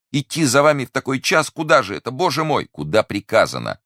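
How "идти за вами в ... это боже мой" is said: high, agitated questioning; alarmed cry. A narrator's reading. Prisoner asks the night official where they're going, crying out in alarm. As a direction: plead to know, voice raised in alarm. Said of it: "вскричал Бонасье".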